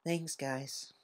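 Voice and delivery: tired soft thanks; weak thanks. A narrator's reading. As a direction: thank them barely above a breath. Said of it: "smiled weakly".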